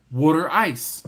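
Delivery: ordinary talk, nothing marked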